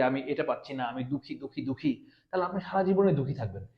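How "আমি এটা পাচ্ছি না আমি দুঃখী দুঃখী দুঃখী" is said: softer, moping imitation of a sad person; mock self-pity. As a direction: whine softly as if feeling sorry for yourself. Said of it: "আপনি যদি দুঃখী হন".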